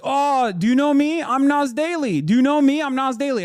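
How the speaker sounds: high, loud mocking imitation of a pushy self-promoter; hammed-up attention-seeking yell. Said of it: "yelling with a phone camera in tow".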